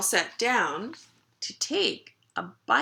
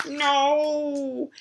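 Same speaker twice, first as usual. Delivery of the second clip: long, higher wailed no; protesting puppy; 6 semitones higher, 3 dB louder than usual. Acted. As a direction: whine a drawn-out refusal as the puppy. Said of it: "what the puppy says now".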